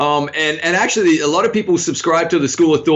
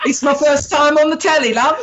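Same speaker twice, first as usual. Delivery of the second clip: mock British accent; 9 semitones higher than usual.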